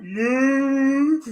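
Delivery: high, held mock moo; cow moo. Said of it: "he said "moo" like a cow".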